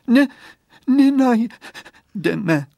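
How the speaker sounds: high, loud, drawn-out sobbed protest; acted sobbing. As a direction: break the words up with sobs. Crying girl refuses comfort.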